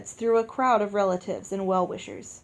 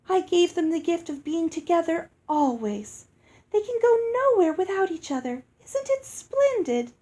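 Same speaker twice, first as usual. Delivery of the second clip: much higher, rising to a gushing peak; delighted musical fairy; 8 semitones higher than usual. A narrator's reading. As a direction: gush over your own splendid gift. Lucinda delighted with her gift.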